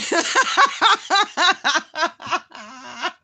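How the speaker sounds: high, loud burst of laughter. Real, not acted. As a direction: laugh out hard.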